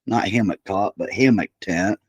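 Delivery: normal talking voice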